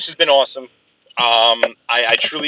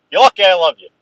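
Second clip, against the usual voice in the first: loving exasperation; 5 semitones higher, 5 dB louder, faster than usual. Real, not acted. Friend joins as stream ends.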